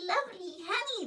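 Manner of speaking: put-on high baby coo, quickened; hurried delighted baby coo. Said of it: "the sticky baby was crying out".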